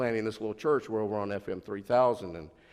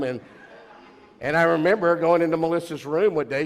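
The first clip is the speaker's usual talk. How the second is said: ordinary talk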